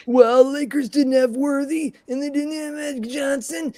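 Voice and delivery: high, mocking know-it-all voice. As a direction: sneer it like a heckler. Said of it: "everyone gave me crap".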